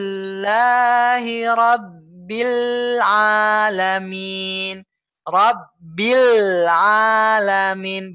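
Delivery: slow chant, the L of Rabbil pressed before Alamin; pressed sukun recitation. Shown as a demonstration. Teacher demonstrating the correct Rabbil Alamin, slow recitation. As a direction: press the bil, then flow on. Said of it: "membaca seperti ini".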